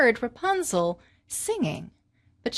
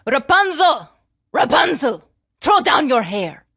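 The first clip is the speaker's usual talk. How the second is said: loud call up to the tower, louder and higher on the name; 3 semitones higher, 8 dB louder than usual. Acted. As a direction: sorceress calling up to the girl in the tower, loud call. Sorceress calling up tower.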